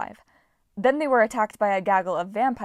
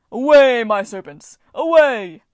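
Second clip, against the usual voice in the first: shouting to the sky; 3 semitones higher, 14 dB louder, slower than usual. A narrator's reading. God shouting to his serpents as he flies off, full shout. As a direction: call the serpents skyward at full voice. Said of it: "Triptolemus shouting to the sky".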